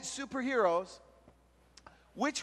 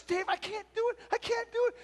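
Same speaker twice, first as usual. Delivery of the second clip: higher, pleading and jittery; nervous; 11 semitones higher, 4 dB quieter, faster than usual. Retold or imitated. Nervous teen begging his youth pastor to let him quit, high and anxious. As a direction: beg off like a scared kid. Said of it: "comes up to me all nervous".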